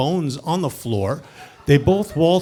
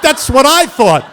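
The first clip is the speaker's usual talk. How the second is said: high, loud, laughing exclamation; shouting agreement with a laugh; relieved shout; 10 semitones higher, 10 dB louder than usual. Retold or imitated. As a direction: blurt it out in relief. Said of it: "I'm like".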